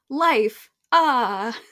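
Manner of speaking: hammed-up elation; so excited. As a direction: gasp out wonder at new life. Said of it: "He's just so excited".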